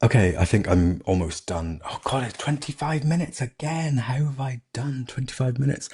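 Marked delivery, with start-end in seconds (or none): 1.8-5.9 high-pitched, exasperated surprise; dismayed disbelief. Real, not acted. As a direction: realise you've run way over time. Notices how long they've talked.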